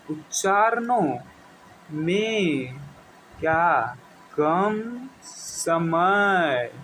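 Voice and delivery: drawn-out, word-by-word dictation for notes; teacher dictating at a crawl; slow dictation. Acted. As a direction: stretch each word so the class can write it down. Teacher dictates definition slowly.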